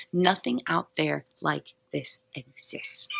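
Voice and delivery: normal talking voice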